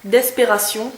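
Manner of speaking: d'espération said the French way, ending -syon; Frenchify d'espération, dess-pay-rah-SYON; French accent. Shown as a demonstration. Vlogger repeating the shade name to viewers in a French accent. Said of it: "avec l'accent français".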